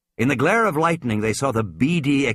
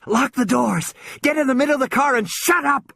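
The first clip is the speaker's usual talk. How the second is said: put-on urgent boy's order; 13 semitones higher than usual. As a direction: bark it at her in panic. Boy orders sister urgently.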